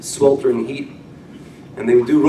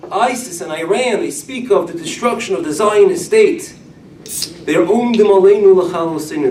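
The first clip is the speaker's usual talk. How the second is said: raised, ringing sermon voice chanting the Haggadah line; preaching at full force; 7 semitones higher, 5 dB louder than usual. Acted. Rabbi to his congregation, preaching loud and high. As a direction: rouse the congregation with the ancient verse.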